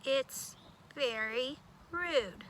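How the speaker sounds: usual speaking voice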